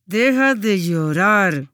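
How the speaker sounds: a fed-up parent in the toy aisle bites out "Deja de llorar" slowly; stern parent. Retold or imitated. Preacher imitating a parent scolding a child at the supermarket, slow and through gritted teeth. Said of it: "un padre con los dientes apretados".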